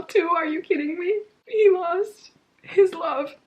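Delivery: high, loud tearful outpouring; crying. Real, not acted. Reader grieving for Haymitch, crying. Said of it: "I literally can't stop crying".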